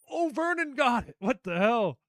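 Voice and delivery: high, loud astonished outburst; real amazement. Real, not acted. Host reacts to a leaderboard upset, amazed. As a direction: blurt it out in high-pitched disbelief.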